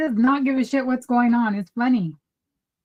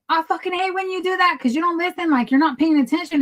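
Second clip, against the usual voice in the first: annoyed retelling; 5 semitones higher than usual. Retold or imitated. Wife telling husband he doesn't listen, mock exasperation. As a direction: re-enact scolding him, pitch lifted in irritation. Acted quarrel with distracted husband.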